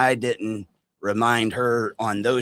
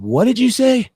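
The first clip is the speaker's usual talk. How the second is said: demanding question; 10 semitones higher, 6 dB louder than usual. Retold or imitated. Confronting Larry, angry retold quote.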